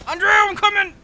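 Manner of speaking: loud, high-pitched shout; calling out. Real, not acted. Player shouting to Andrew that help is coming, urgent yell. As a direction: yell out to a far-off teammate.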